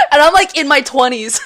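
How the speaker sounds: laughing as she talks. Real, not acted. Laughing at herself while telling the glass story. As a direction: giggle through the words.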